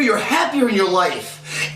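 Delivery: ordinary talk, nothing marked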